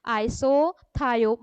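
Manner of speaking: isothiocyanate said slowly, syllables separated; deliberate teaching pace; slow for repetition. Shown as a demonstration. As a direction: model the word for the class to echo. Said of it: "आप मेरे पीछे repeat करते जाइए".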